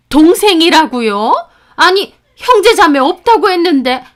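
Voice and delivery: high, loud startled exclamation; shocked. Acted. Mother stunned to learn of a sister, loud surprised outburst. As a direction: blurt it out in disbelief.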